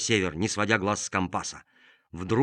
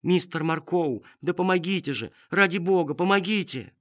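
high, desperate plea for help; pleading cry; 8 semitones higher than usual. Acted. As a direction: beg for rescue from afar. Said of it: "жалобный, умоляющий крик".

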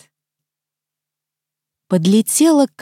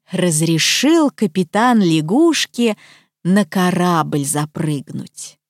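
usual speaking voice

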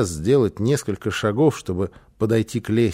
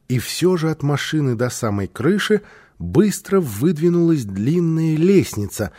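ordinary delivery, nothing marked

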